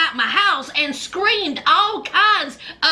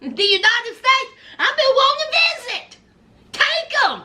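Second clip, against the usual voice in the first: higher, faster, eager mother's voice; mock eager mama; 6 semitones higher than usual. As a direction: gleefully wave him off as an eager mama. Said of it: "Mama Nikolai was like".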